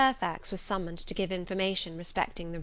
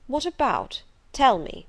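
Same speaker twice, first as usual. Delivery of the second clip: insistent; 5 semitones higher, 6 dB louder than usual. Acted. Rochester insists.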